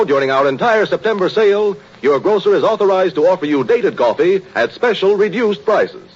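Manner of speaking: normal talking voice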